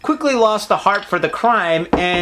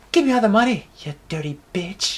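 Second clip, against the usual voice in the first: a voice actor voice. Pretend. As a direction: play it like a dubbed tough guy. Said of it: "let's do a voice".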